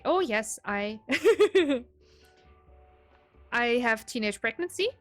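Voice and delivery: normal talking voice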